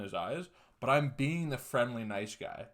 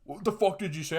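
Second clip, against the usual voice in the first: higher, louder retold outrage; mock outburst, pitched up; freaking out; 7 semitones higher, 5 dB louder than usual. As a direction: imitate an indignant challenge. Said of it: "it starts freaking out on me".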